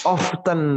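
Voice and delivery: T sounded in "Often". Shown as a demonstration. Teacher repeating the first option, Often as "off-ten". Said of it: "T-nya dibaca often boleh".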